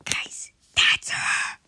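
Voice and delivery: slow hushed whisper to viewers. Real, not acted. Player whispering to viewers as he spots his target. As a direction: whisper so she won't hear. Spotting the lady, hiding.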